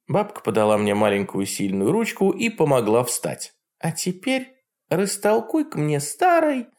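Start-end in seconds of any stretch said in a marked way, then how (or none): 3.8-6.7 high mocking old witch; Baba Yaga voice. A narrator's reading. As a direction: sneer like a jealous hag. Yaga speaks.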